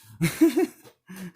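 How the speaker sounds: big high cackle at his own joke; loud burst of laughter. Real, not acted. Vlogger cracking up at his wiretap pancake joke, loud laugh.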